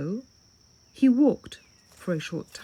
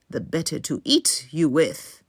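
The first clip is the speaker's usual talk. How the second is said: louder, growled wolf voice; menacing wolf; 2 semitones lower, 7 dB louder than usual. A narrator's reading. Wolf answering the girl, louder menacing growl. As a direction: let the wolf pounce with the words.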